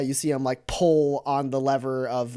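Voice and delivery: ordinary talk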